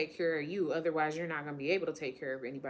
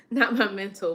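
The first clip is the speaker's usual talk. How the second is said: laughing; 4 semitones higher, 10 dB louder than usual. Real, not acted. Laughing at own slip.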